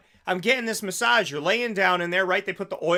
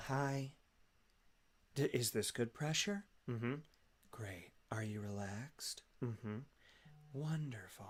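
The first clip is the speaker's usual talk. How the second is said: hushed, much softer, sweet masseuse murmur; 6 semitones lower, 18 dB quieter than usual. Pretend. Ideal masseuse soothing a client on the table, hushed sweet whisper. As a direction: breathe it out gently, barely voiced. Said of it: "a sweet voice and say less".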